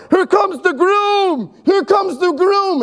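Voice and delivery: high, slow calling out to the street; retold yell. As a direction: call it out high and drawn out so the whole street hears. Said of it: "It was a shout".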